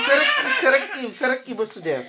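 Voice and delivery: high laughing cry of move. Real, not acted. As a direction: laugh it out while shoving. Laughing, pushing child aside. (हसत हसत उंच आवाजात सरक)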